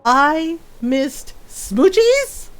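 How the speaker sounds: slow, word-by-word outraged question; mock upset. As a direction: imitate a friend's dismay, rising at the end. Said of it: "she gets so upset".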